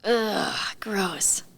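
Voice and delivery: grossed-out groan, breathy whisper on gross; disgusted ugh. Real, not acted. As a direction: recoil from the slime. Reaction to talk of slime.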